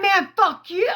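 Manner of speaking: high, loud repeated curses, hammed up; imitated defiant shouting. Imitating the cousin cursing at the family in court, high and loud. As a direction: fire each one off at a different person. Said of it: "He was like".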